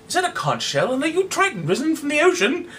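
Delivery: sing-song it like a poet; lyrical. Acted.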